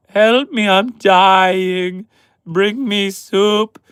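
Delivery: high, drawn-out dramatic whimper; whiny sick man. Acted. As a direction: ham up a dying man with a cold. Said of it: "Us men tend to".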